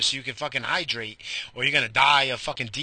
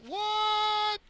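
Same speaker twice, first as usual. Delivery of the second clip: surprised. Real, not acted. Amazed by the chocolate milk.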